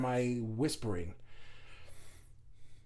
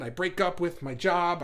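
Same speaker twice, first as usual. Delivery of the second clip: usual speaking voice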